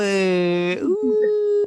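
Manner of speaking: high, loud mock ghostly ooh; a hammed-up spooky ooh.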